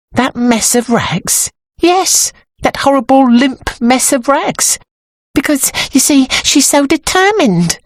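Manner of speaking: high-pitched, uneasy character voice; high voice, dwelling on the horror; anxious dressmaker's assistant, uneasy. A narrator's reading. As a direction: confide a dread in a high voice.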